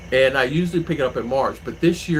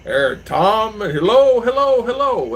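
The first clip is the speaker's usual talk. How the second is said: sing-song hellos, higher; imitated greeting; 9 semitones higher, 6 dB louder, slower than usual. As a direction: mimic staff greeting Tom. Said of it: "and they'll do that".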